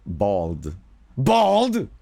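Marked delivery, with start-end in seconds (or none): 1.2-1.9 loud, high yell of one word; character shout. Acted. Streamer showing how his own voice sneaks into a character, shouted. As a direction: belt it out, pitch jumping way up.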